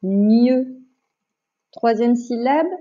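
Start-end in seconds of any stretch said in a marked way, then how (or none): 0.0-0.8 deliberate, held syllable; drawn-out syllable for a pupil to write; dictation. Shown as a demonstration. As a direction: dictate one syllable clearly. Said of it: "Je répète".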